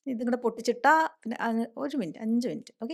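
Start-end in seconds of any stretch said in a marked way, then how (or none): none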